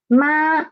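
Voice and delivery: ma high and level; first tone ma. Shown as a demonstration. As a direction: hold ma up high and flat. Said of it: "So it is a level, flat sound".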